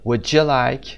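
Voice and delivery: "Would you" blended into "wou-ja like"; linked "Would you", with a j in the middle; merged d-y sound. Shown as a demonstration.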